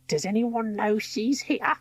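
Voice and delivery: hushed villainess. A narrator's reading. Villainess voice, slow.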